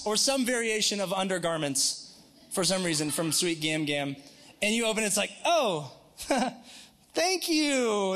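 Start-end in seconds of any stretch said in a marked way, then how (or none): 5.4-8.1 high, drawn-out mock thanks; feigned gratitude. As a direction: stretch out a polite thank-you to cover disappointment. Acted reaction to disappointing gift.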